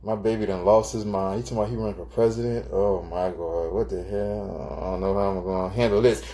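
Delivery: hammed-up worried partner, slowing on what the hell; lightly voiced overwhelmed wife; panicked girlfriend. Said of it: "You don't say".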